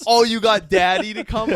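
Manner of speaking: high, loud teasing voice; mock the guy for calling daddy; high, loud mocking fireman; mocking firemen.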